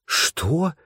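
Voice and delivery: high, astonished question; shocked gasp. A narrator's reading. Tananda hears the prize is gone, shocked gasp. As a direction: gasp it out, voice leaping up. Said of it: "ахнула Тананда".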